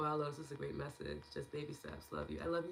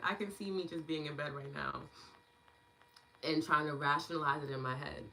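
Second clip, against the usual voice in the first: usual speaking voice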